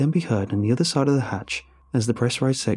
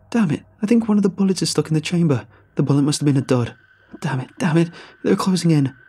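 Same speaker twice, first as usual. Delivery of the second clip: panic; 6 semitones higher, 4 dB louder than usual. Acted. Ranger under attack, jammed gun.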